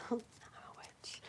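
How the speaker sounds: soft slow whispered witch joke; spooky little whisper; whispered mock confession, laughing; joking whisper. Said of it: "I'm just kidding".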